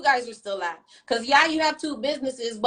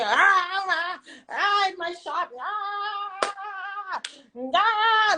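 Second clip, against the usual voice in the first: high, scoffing ah ah ah, a hint of her fuss; lightly imitating the old lady's outrage; mocking the angry woman; 7 semitones higher, much slower than usual. Mocking the shop owner's angry reaction, high and scoffing. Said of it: "how angry she sounded".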